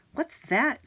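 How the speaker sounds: high, loud startled question. Acted. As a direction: jump at a strange sound. Jack hears howling nearby.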